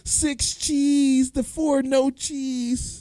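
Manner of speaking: calling out orders. Retold or imitated. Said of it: "when you called it out".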